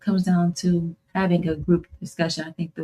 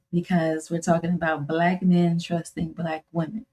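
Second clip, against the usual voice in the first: ordinary delivery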